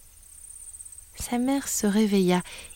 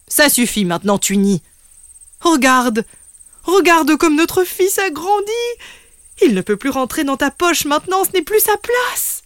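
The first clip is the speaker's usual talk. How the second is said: lightly voiced booming father, louder; big voice; 8 semitones higher, 4 dB louder than usual. Papa kangaroo settles it with his wife, firm big voice. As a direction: speak as the firm proud papa. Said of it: "une grosse voix retentit".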